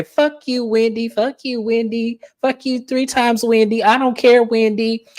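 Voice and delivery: high-pitched mock comeback; imitating Kay. Kay's only comeback to Wendy's shade, mocking retelling.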